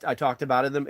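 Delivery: ordinary talk, nothing marked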